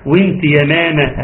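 slow وانت يا ماما with thin "meme"; light meem. Shown as a demonstration. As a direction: front the vowels in يا ماما, drawn out. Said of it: "بترقيق الميم". (وانت يا ماما بميم مرققة)

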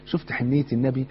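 ordinary talk, nothing marked

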